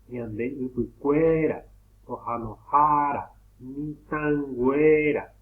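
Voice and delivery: upucuera, ojanojara, mitangüera with long, punched stressed vowels; exaggerated stressed syllable. Shown as a demonstration. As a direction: slowly sound out upucuera, ojanojara, mitangüera, each next-to-last syllable loud and stretched. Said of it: "vamos a pronunciar a ver dónde suena más fuerte".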